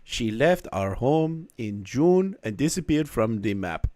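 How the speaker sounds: badly faked French Canadian accent, sliding into Jamaican; attempted posh French Canadian accent. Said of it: "a posh Canadian accent".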